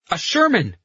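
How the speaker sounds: high call, dropping at the end; excited cry. A narrator's reading. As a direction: sing out the sighting in a high shout. Carletto cries out sighting the tank.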